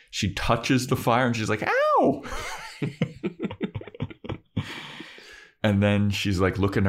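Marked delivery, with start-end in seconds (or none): 1.6-2.2 squeaky high yelp of pain; a sudden high cry of ow; mock yelp. Buffy touches fire, retold. 2.3-5.0 high wheezy laughter; breathless high-pitched laugh. Real, not acted. Laughing at own impression.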